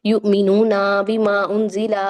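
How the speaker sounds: loud flowing युमिनूना बीमा उंजिला with long endings; lengthened endings. Shown as a demonstration. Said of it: "उसकी हरकत लंबी चली जाती है".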